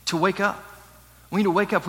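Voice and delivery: usual speaking voice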